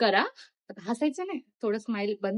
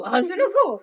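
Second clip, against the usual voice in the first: breaking into a laugh, higher and louder; 9 semitones higher, 8 dB louder, much slower than usual. Real, not acted. Girl cracking up while directing her sister, laughing. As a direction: crack up mid-line. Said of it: "हसायचं".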